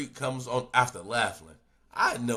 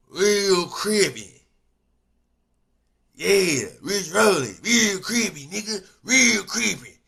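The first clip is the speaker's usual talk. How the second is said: much higher, loud hammed-up chant of real crippy; mock gangster hype shout; mock hype yell; 10 semitones higher, 13 dB louder, slower than usual. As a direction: shout it like a hyped banger. Mocking a gang member's boast.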